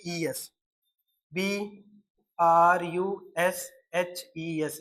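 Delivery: slow dictation of letters to students; letter-by-letter spelling. Shown as a demonstration. Teacher spelling the word letter by letter, slowly. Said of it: "यानी कि brushes.".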